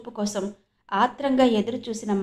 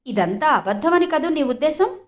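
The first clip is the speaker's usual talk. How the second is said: higher, probing suspicious question; 5 semitones higher, 4 dB louder than usual. A narrator's reading. Jyoti doubting Madhav believes her, suspicious. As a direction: challenge his doubt. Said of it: "జ్యోతి అనుమానంగా చూస్తూ".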